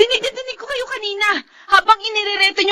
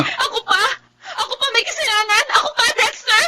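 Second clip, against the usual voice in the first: tearful, higher-pitched indignant outcry; crying protest; 5 semitones higher, 7 dB quieter than usual. Acted. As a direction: sob out the accusation in disbelief. Wife accused, fights back with husband.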